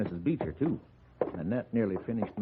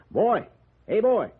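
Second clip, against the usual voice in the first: loud, high call across the yard; 11 semitones higher, 7 dB louder, slower than usual. Acted. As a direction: shout for the boy to come. Calling out to the wild boy.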